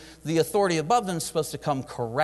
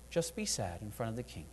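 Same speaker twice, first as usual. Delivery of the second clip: much softer, hushed reply of God; gentle divine voice; 5 semitones lower, 11 dB quieter than usual. Acted. The Lord quietly telling Nehemiah just to be sad. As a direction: say it softly, like God calmly insisting.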